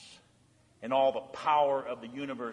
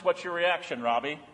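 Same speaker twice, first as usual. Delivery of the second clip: ordinary talk, nothing marked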